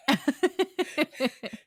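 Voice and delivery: high burst of real giggles; helpless laughter. Real, not acted. Cracking up before the intro, helpless laughter. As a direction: burst out laughing before you can begin.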